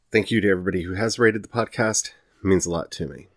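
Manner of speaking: ordinary delivery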